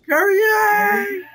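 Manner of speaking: high, drawn-out name call; hammed-up shout. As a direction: cry out the name like an anime hero. Reads chat in anime-style call.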